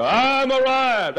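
high, slow, drawn-out boast; mock self-important cry. College fellow announcing his arrival, high mock-proud cry. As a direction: crow it like a puffed-up graduate. Mocking a puffed-up college man.

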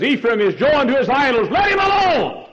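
high, loud scripture quote; preaching scripture. Acted. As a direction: ring out let him alone. Quoting scripture at full force.

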